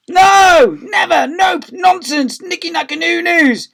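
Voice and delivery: high shouted protests; loud complaining. A narrator's reading. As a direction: cry out like a crowd of outraged teachers. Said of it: "began complaining loudly".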